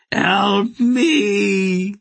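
high, loud desperate plea for help; terrified scream. A narrator's reading. A doomed disciple screams to be saved, terrified. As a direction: scream it as you glow and burn. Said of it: "he screamed".

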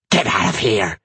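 low, rough witch voice; witch spitting words. A narrator's reading. Witch throwing the narrator out, spat. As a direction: spit it out as an angry witch. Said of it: "she spat".

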